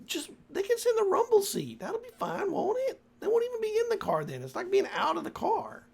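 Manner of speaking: put-on very high, bright old mother's voice, fast near the end; high-voiced ma. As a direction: squeak out the mother's lines up high. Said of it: "and she goes".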